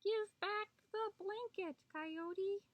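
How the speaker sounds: high, small hummingbird voice; tiny voice. A narrator's reading. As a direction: speak as a tiny creature. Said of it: "he heard a tiny voice".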